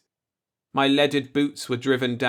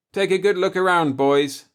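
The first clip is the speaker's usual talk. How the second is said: raised, louder call to the crew; rally the men, higher and louder; narrator's commanding voice; 7 semitones higher, 6 dB louder than usual. Acted. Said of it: "I said".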